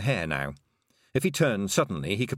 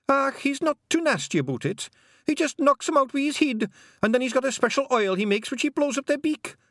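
high Scottish pixie voice, louder; cheeky wee Scots pixie; 3 dB louder than usual. A narrator's reading. Feegle pixie voiced in story.

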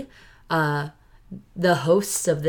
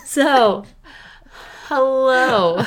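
high bright hello, louder; excited hello; 6 semitones higher, 4 dB louder, much slower than usual. Acted. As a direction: greet them with a big grin. Silly podcast greeting again.

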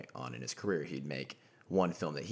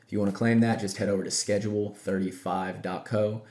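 ad read; 2 semitones higher, 8 dB louder than usual. Acted. Host reads sponsor ad.

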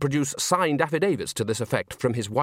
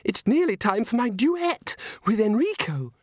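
put-on very high, fussing girlish voice; excitable young soprano; 10 semitones higher than usual. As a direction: fret in a high-pitched voice. Christine's high excitable figure voice.

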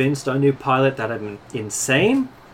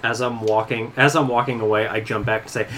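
usual speaking voice

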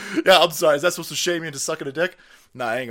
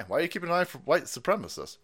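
usual speaking voice

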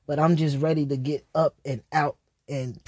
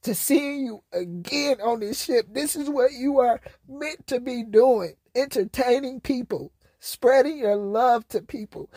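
tearful quote; 7 semitones higher than usual. Real, not acted. Quoting ship staff warmly.

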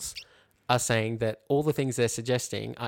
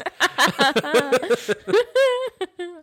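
high burst of helpless laughter; big real laugh; loud laugh; 9 dB louder than usual. Real, not acted. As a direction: crack up loudly. Co-host cracks up at picture.

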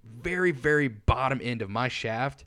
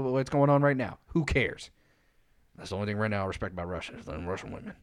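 ordinary talk, nothing marked

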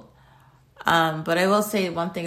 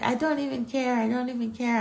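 mock mom; 6 semitones higher than usual. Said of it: "my mom was like".

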